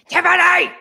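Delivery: high, loud mock shout of a name; acted-out patience snapping; exasperated yell. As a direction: suddenly bellow to get someone out of bed. Acts out losing patience waking her.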